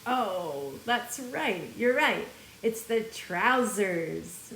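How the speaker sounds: ordinary delivery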